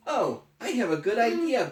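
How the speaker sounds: high, bright-eyed character voice; Elephant voice. Acted. Parent reading to a child, voicing Elephant high. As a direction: read Elephant high and eager.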